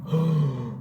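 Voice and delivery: sharp acted gasp of shock; imitated sudden intake of breath; startled gasp. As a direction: gasp as if hit by a huge bang. Imitates reaction to the gunshot.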